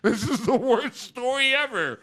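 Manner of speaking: high, slow, crying over the sad story; mock sob. A viewer moved to tears by a sick kid's story, mock sobbing. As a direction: wail it out half laughing. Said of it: "and you're like".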